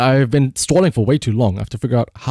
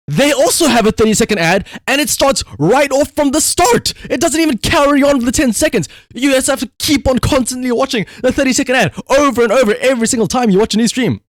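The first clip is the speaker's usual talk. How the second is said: exasperated rant; 9 semitones higher than usual. Real, not acted. Streamer ranting to chat about repeated ads, exasperated and high. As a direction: voice climbs high in mounting exasperation.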